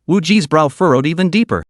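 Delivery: plain reading voice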